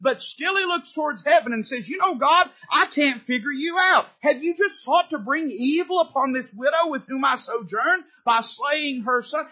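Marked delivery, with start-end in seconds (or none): 1.9-9.5 mad at God. Retold or imitated. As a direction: demand an answer from heaven. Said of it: "He sounds sort of mad".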